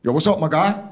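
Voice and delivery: a light take on a friendly gym lad; gym guy. Retold or imitated. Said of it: "he goes".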